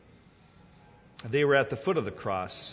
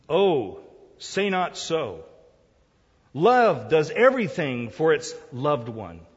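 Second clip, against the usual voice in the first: loud, slow, raised poetic declamation; declaimed poem; 5 semitones higher, 10 dB louder, slower than usual. Acted. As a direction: proclaim the verse with drawn-out words.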